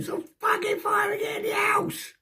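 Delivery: very high, strained yelling; acted-out angry shout; retold shout. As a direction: yell it like an exasperated housemate. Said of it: "So I shouted at him".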